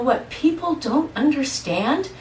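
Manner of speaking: ordinary talk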